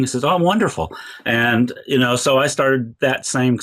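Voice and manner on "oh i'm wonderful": cheery wonderful. Retold or imitated. Retold founder's cheerful reply.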